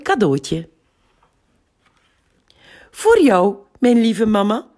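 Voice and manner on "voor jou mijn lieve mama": put-on high, bright girl's voice giving a gift; a small girl hands her drawing to mum; happy child. A narrator's reading. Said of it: "lacht Anna".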